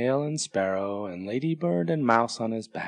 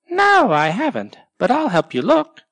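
much higher, louder bright bird voice; Sparrow helping; 11 semitones higher, 7 dB louder than usual. Acted. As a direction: chirp the offer cheerfully. Sparrow offers help.

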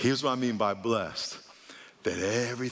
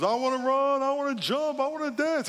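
very high, pleading comic voice; high sing-out, each wish pushed harder; eager man seeking healing; 13 semitones higher than usual. Retold or imitated. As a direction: imitate an overexcited believer begging for a miracle. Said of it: "this guy starts running down... And he goes".

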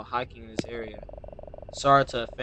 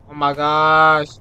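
slow and louder; dread; 3 semitones higher, 11 dB louder, slower than usual. Real, not acted. Gamer creeping through woods, slow and loud. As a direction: stretch it out in dread.

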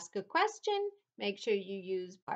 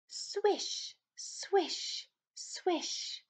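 breathy high swish of a broom; 9 semitones higher, much slower than usual. A narrator's reading. Broom sound imitated.

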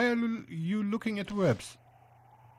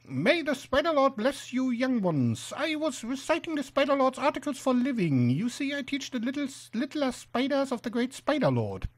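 put-on high-pitched old spider preacher; shrill would-be somber spider; 7 semitones higher than usual. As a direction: bless them solemnly in a high voice. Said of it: "shrill, high-pitched voice sort of spoils the effect".

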